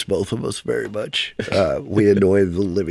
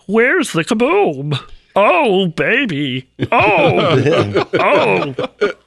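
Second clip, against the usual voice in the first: high, slow little alien whine; Marvin the Martian; 9 semitones higher, much slower than usual. Retold or imitated. Comic on stage for his wife, Martian cartoon voice. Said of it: "Marvin the Martian going".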